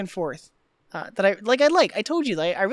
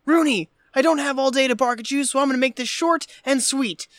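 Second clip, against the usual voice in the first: louder, higher put-on authority; mock irate caller; 5 semitones higher, 4 dB louder than usual. Imitating Cameron posing as an angry father, mock stern. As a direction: lay down the law in a hammed-up voice.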